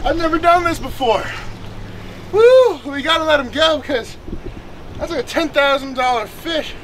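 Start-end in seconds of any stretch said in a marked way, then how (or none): 2.3-2.8 loud, high cheer of joy; excited whoop. Real, not acted. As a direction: whoop like you just won. Cheering after catching fish.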